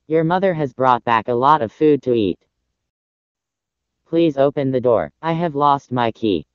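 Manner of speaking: low voice calling through the door; wolf greeting the goats in his deep voice. Acted. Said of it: "said in his deep voice".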